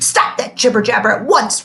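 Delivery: loud roar. A narrator's reading. The strict teacher roaring at whispering students, loud. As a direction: roar at the class. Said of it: "roared Mister Kinderschmacher".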